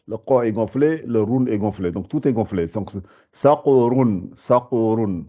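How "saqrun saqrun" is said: saqrun with every sound heavy. Shown as a demonstration. Teacher repeats saqrun, all sounds heavy. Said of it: "Faut vraiment tout gonfler".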